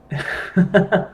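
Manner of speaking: a little laugh between words; short laugh. Real, not acted. Host wrapping up a stream, short laugh.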